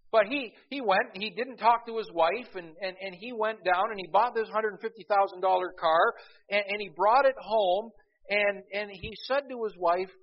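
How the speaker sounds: high, loud preaching burst; rising excitement. Acted. Preacher to congregation, loud, high and fervent. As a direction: pitch the story up with fervor.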